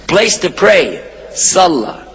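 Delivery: ordinary talk, nothing marked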